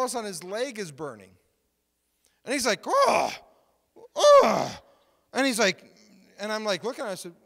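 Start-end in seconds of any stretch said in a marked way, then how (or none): 2.9-4.8 two loud, high "oh"s, the second higher; startled cries. Retold or imitated. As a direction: imitate someone reacting to a burning leg. Imitates man whose leg burns.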